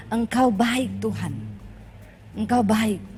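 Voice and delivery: low, subdued prayer, falling away on each phrase; retold tearful prayer. Preacher retelling her prayer in hardship, subdued tearful surrender. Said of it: "Saya nangis sama Tuhan".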